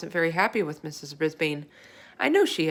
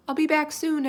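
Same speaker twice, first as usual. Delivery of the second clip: calling back; 9 semitones higher, 4 dB louder than usual. A narrator's reading. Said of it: "I called back".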